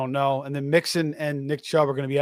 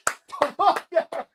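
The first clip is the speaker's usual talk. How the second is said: excited laugh. Real, not acted. Laugh right after cheering a homer.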